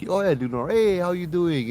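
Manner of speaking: high-pitched, breezy pretend greeting; feigned recognition. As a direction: play it off as if you know her. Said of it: "he kinda plays it off and pretends".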